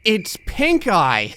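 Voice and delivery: high, drawn-out mock whine; protesting kid. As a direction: whine back at mom, stretching it. Child protests to mom.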